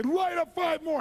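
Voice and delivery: high, loud yell; unhinged bullying command; crazed shouted order. Retold or imitated. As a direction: scream the order. Crazed therapist ordering the smoker.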